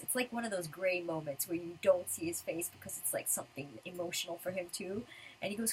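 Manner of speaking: normal talking voice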